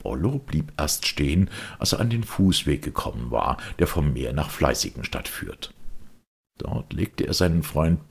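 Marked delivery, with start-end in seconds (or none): none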